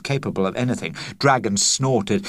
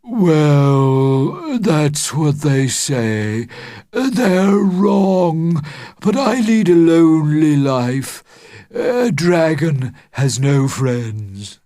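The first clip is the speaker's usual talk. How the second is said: higher, slow, crying dragon lament; lonely dragon close to tears; sad dragon; 6 semitones higher, 5 dB louder, slower than usual. Acted. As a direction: let the voice break with loneliness.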